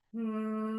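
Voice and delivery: long, soft, even hum; sustained bee drone; closed-lip hum. Shown as a demonstration. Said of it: "one more time".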